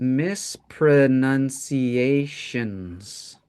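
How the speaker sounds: mispronunciations drawn out syllable by syllable; slow and careful. Shown as a demonstration. Teacher modelling a word for the class, slow and careful. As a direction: stretch mispronunciations so each syllable is heard. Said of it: "that's a soft sound".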